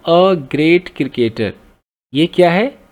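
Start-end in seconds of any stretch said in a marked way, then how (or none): 0.1-1.5 stretched out, deliberate; each word drawn out; very slow reading. Shown as a demonstration. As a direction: hold up the phrase slowly. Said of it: "ये जो आ गया ना".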